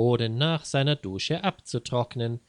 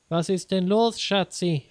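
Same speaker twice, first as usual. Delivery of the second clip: higher, a touch of concern; mild worried wife calling out; worried question; 6 semitones higher than usual. Acted. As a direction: ask a little anxiously, pitched up. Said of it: "fragte seine Frau besorgt".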